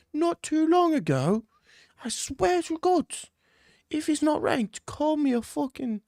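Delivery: very high, drawn-out mock-British indignation; high-pitched, swooping British outrage; outraged British character. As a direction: squeal it slowly like an affronted Englishman.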